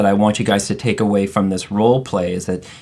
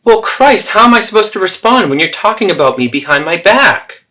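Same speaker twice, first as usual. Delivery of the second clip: much higher, louder indignant outburst; indignant mother; 10 semitones higher, 6 dB louder than usual. Acted. Mother accusing daughter of talking behind her back, indignant. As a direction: cry out as if betrayed. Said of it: "So there you have the three role plays".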